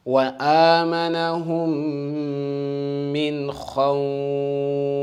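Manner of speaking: chanted recitation with full mouth خَوْفٍ; Quran recitation. Shown as a demonstration. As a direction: recite the verse, heavy خَوْفٍ. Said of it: "you have to start saying, example".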